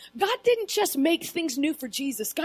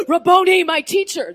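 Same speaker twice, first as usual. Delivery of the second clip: astonished cry; 2 semitones higher, 8 dB louder than usual. Retold or imitated. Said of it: "And she's like".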